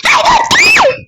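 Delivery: high, loud defiant yell; defiant shout. Real, not acted. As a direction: shout it back at your playmate. Snapping back in squabble with playmate. (pasigaw na pagsuway)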